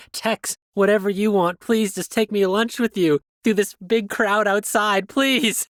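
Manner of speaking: high-pitched, urgent begging in a hammed-up panic; frantic pleading. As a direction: plead loudly to be taken along. Acts out begging in role play.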